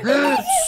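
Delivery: high startled gasp of alarm; sharp gasp. Real, not acted. Player gasps in alarm as the villager attacks, high gasp. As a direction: gasp at the sudden threat.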